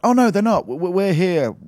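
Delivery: high-pitched flustered reply; flustered retelling. Retold or imitated. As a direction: answer in a high, loud voice. Retelling own flustered doorstep reply.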